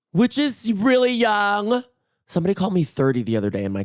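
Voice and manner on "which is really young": high, drawn-out protest; mock defensive insistence. Mock defensive about her age.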